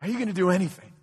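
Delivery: mild tearful heartbroken plea. Acted. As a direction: ask it as if through tears. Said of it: "he says it with tears in his eyes and a broken heart".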